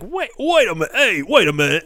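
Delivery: high, insistent mock objection; indignant protest. Robbed British pub objects to the theft, indignant protest.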